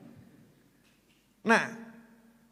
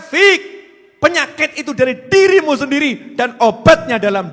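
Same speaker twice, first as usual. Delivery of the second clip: slow, loud, higher preaching voice; forceful preaching; 10 semitones higher, 12 dB louder than usual. Real, not acted. Preacher rebuking the congregation with slow, loud, forceful words. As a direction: drive each word home to the congregation. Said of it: "preaching rebuke at full force".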